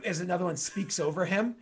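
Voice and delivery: usual speaking voice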